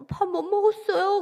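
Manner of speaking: high-pitched, lightly acted plaintive reply; welling sorrow. Hungry defector answers the motherly server, plaintive and high. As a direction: retell it like a child upset before mother. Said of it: "서러움이 막 한꺼번에 폭발한 거예요".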